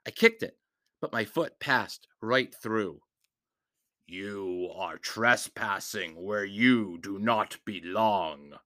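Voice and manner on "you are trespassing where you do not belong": low, slow, lifeless rasp; a hollow, dead monster speaking slowly; raspy hollow shadow voice. Acted. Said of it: "with a raspy voice that sounded hollow and lifeless".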